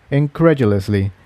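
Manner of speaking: ordinary delivery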